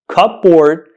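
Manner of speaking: cupboard as "cup-board", P and B both sounded; wrong pronunciation. Shown as a demonstration. Teacher naming the error for learners, cupboard as "cup-board". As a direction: give "cupboard" a full second syllable, "board". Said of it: "is the wrong pronunciation of this word".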